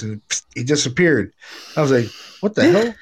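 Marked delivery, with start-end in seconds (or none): 2.4-2.9 high, loud, laughing burst of disbelief. Retold or imitated. Retelling his uncle vanishing in a dream, laughing disbelief. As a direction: blurt it out through a laugh, stunned.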